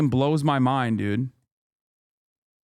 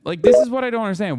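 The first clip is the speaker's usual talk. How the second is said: louder and higher, fast; exasperated outburst; 7 semitones higher, 5 dB louder, faster than usual. Real, not acted. Venting at liberals, loud fast frustrated outburst.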